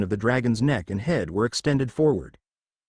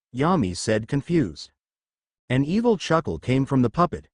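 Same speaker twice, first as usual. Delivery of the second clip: plain reading voice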